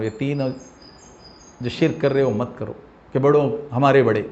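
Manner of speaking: ordinary talk, nothing marked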